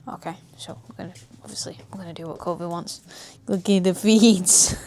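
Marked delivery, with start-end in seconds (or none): 3.5-4.8 delighted call. Real, not acted. Excited about puppy playing.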